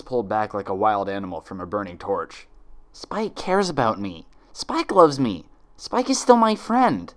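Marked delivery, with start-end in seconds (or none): 2.9-7.1 slow, building to a loud high cry; each line lifts higher; rising relief. Acted. Twilight breaking free, voice swelling.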